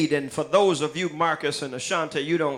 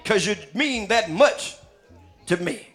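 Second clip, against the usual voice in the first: preaching the lyrics; 4 semitones higher than usual. Acted. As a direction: preach the song lines to the church with mounting fire. Preacher declaims song lyrics, rising.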